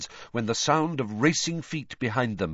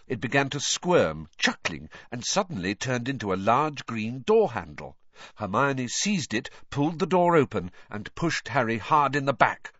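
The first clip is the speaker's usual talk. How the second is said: ordinary delivery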